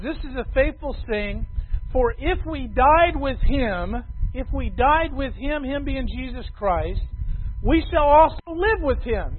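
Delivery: booming preacher reading; preaching. Acted. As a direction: ring it out like the pulpit verse it is. Quoting scripture from the pulpit.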